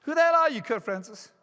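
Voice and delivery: high, loud acted challenge; indignant demand. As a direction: belt out the accusing question. Accuser challenges him again.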